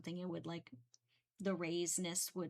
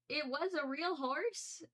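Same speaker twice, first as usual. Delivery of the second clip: disbelieving question; 8 semitones higher, slower than usual. Real, not acted. Realizing her mistake.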